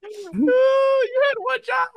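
very high, drawn-out cry; high, stretched-out scolding; exasperated wail. Real, not acted. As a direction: wail it slowly in comic outrage. Berating player for bad roll.

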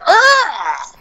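high loud fiery screech; dragon roar. Pretend. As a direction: shriek like a fire-breathing dragon. Dragon breathing fire.

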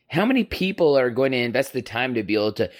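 usual speaking voice

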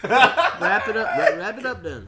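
loud, high, laughing order. Real, not acted. Host telling co-host to end the show, laughing. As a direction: cut it off through laughter.

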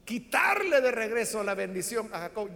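ordinary delivery

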